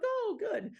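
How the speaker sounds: high-pitched, imitated "oh, good"; impressed reaction. Town hall staff hearing about the American coach, impressed.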